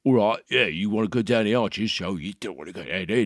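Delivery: put-on loud cockney geezer, East End accent; cockney uncle. Comedian mimics a cockney uncle from a sitcom. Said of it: "that's Uncle from, uh, Only Fools and Horses".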